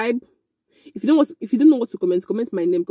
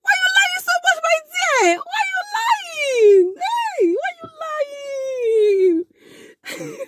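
much higher, furious cry; a shrill, breaking, near-tearful shout, much higher; shouting at him. Retold or imitated. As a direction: yell at the liar in disbelief.

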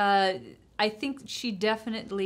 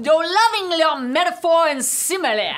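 mock Louisiana accent; 5 semitones higher, 8 dB louder than usual. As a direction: holler it like a Louisiana political brawler. Riffing as Louisiana couple Carville and Matalin.

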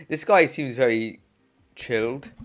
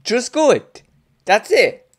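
character voice; 10 semitones higher, 11 dB louder, slower than usual. Acted. Reading a character line aloud.

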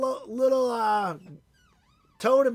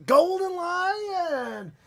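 excited wheel reveal; 8 semitones higher, much slower than usual. Real, not acted. Wheel stops on the pack.

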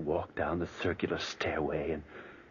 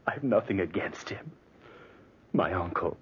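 ordinary talk, nothing marked

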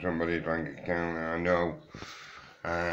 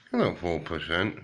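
usual speaking voice

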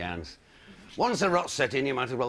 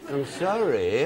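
ordinary talk

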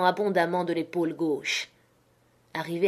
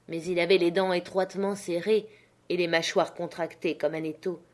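ordinary delivery, nothing marked

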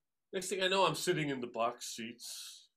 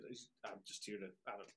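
much softer, giving up; resigned mumble; 6 semitones lower, 15 dB quieter, faster than usual. Retold or imitated. Drummer resigned to losing the gig, soft and deflated. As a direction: mutter it off as if it doesn't matter. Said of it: "I said".

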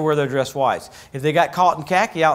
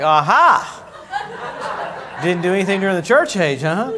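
higher, laughing teasing jab; mock gloating; 3 semitones higher, 4 dB louder, slower than usual. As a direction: tease them with a laughing "Aha!". People mocking skimpy heavenly robes.

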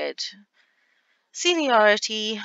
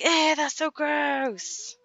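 high, very slow grossed-out whine; disgusted teen voice; 8 semitones higher, much slower than usual. Acted. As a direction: drag out the disgust like a teenager. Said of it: "Imagine Lillian being like".

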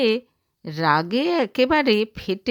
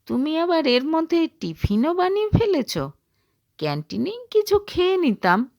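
higher, quicker, caught off guard; surprised husband; 6 semitones higher, faster than usual. Acted. Husband finding his wife packed lunch, surprised and quick. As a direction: react as if taken aback, quickly.

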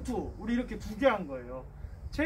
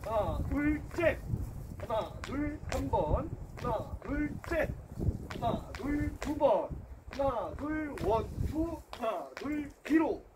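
higher counted workout calls; coach count calls; 6 semitones higher, slower than usual. Shown as a demonstration. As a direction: call the beat for the class. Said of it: "그러면은 잽 시작".

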